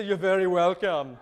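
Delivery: lightly put-on German accent; joking German-accented reply. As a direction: say it with a touch of a comic German accent. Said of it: "And in a German accent".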